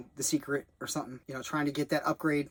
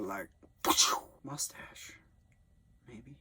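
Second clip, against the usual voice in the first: whisper; 2 semitones lower, 3 dB quieter, slower than usual. Real, not acted. Whispered aside about producer.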